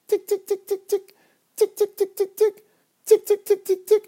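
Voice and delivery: very high clicky tick tick of a pickaxe; high ticking pickaxe noise. Retold or imitated. Dreamer imitating his pickaxe on the diamond, high ticking. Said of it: "it sounds like this".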